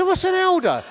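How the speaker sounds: high, comically clueless question; mock incredulous query; baffled questioning. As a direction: pitch it up like a lost teenager. Teenager baffled again, acted.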